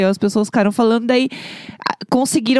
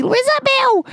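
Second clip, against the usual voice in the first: high shouted exclamation; shouted name; slower than usual. Retold or imitated. Reacting to the kids at the party, high shouted cry. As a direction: yell the name out in shock. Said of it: "e eu assim".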